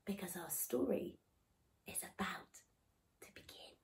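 hushed whisper. Acted. Teller hushing the children as the story starts. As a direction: draw them in with a dying whisper. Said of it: "whisper building suspense".

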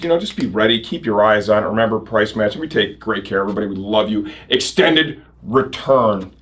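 ordinary talk

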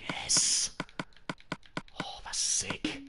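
slow, voiceless whispered delight; excited whisper. Real, not acted. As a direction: whisper your glee to yourself. Quiet excitement over diamonds.

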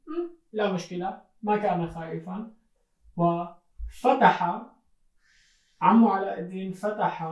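ordinary talk, nothing marked

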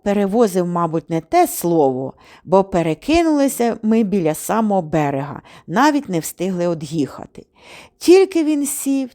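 usual speaking voice

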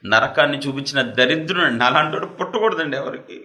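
normal talking voice

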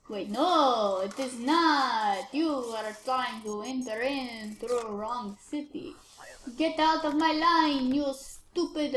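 drawn-out officer's refusal; slow, deliberate scolding. Pretend. Officer rejects traveller at wrong city, slow drawl. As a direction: stretch each word as you dismiss them.

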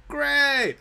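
loud, high sarcastic exclamation; mock cheer. Player finding he has no torches, mock cheer. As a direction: burst out in false delight.

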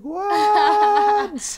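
very high, loud drawn-out squeal; amazed shriek. Retold or imitated. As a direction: imitate an overjoyed, disbelieving scream. Meghan's amazed reaction retold.

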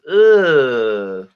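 loud, drawn-out "ugh"; hammed-up yuck noise; disgusted groan. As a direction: groan as if tasting something bitter. Acts disgust at bitter coffee.

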